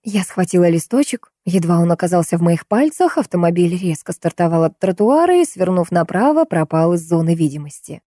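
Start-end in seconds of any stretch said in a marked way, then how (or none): none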